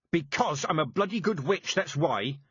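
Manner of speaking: loud, high snarling boast; old witch snarling back; snarled. A narrator's reading. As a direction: growl the pride out. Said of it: "snarled Mistress Weatherwax".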